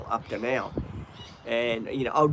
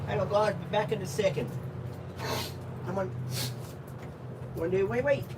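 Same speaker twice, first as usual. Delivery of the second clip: high, sing-song voice for the dog; doggy talk; 6 semitones higher than usual. Acted. Owner coaxing his dog to find the ball, high sing-song. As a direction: coax the dog like a pet owner.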